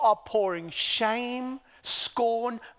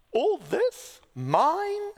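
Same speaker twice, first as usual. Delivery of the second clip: mock gasp; 6 semitones higher, much slower than usual.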